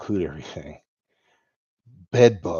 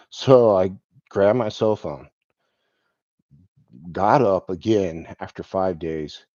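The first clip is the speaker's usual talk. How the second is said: ordinary delivery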